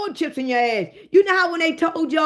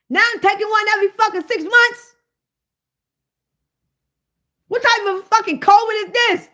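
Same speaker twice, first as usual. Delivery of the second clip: angry rant; 5 semitones higher, 3 dB louder than usual. Real, not acted. Angry rant at vaccine rules.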